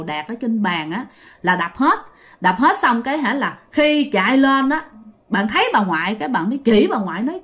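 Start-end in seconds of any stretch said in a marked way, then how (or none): none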